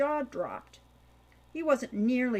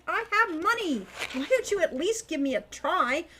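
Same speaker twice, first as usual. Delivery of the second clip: loud, high, indignant demands; frustrated boy shouting; pleading shout; 7 semitones higher, 5 dB louder than usual. Acted. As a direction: yell through the door, fed up. Keeps shouting at closed door.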